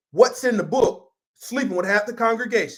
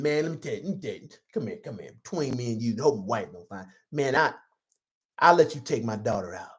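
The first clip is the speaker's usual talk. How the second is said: low, hushed conspiratorial dealmaker voice; low sly hush, softer and lower; sly boyfriend; 8 semitones lower, 3 dB quieter than usual. Acted. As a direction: lean in and cut a shady deal in a low murmur. Preacher voices heathen boyfriend's deal.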